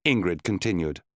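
ordinary delivery, nothing marked

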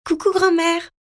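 put-on a small boy running to his grandmother, calling out happily; little boy greeting grandma with a high excited call; excited child greeting. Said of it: "s'exclame Owen".

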